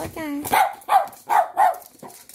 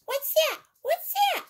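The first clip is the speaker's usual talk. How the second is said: much higher squeaky question rising each time; squeaky toy question; 12 semitones higher, 3 dB louder than usual. Acted. Toy asks repeatedly.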